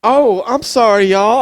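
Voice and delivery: high, loud sweet Southern belle; Southern girl accent. Retold or imitated. Mocking a naive college girl, high Southern accent. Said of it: "that accent is ridiculous".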